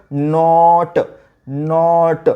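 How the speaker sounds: नॉट slowly, long open vowel; phonics blending. Shown as a demonstration. Teacher blending N, O, T into stretched नॉट. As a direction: draw out नॉट, then release ट. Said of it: "काय होतं?".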